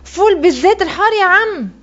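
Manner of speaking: put-on high-pitched boy's call; calling from afar. A narrator's reading. Boy runs toward the shop, calling to the seller. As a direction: sing out the order from a distance. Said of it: "وهتفت بالبياع وأنا على مبعودة أمتار".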